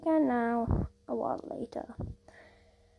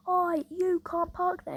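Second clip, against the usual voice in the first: slow, higher "Oi" from a stern driver; bus driver calling out; 6 semitones higher, 3 dB louder, slower than usual. Retold or imitated. Bus driver calls out to the parked taxi, slow stern shout.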